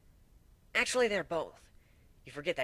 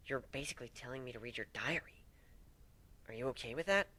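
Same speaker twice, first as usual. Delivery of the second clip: whisper; 6 dB quieter than usual. Acted. Hesitant reply to trusting partner.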